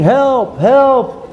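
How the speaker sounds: high-pitched mock cry for help; imitated screaming. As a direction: call for help as if from a rooftop, toned down. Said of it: "he starts screaming".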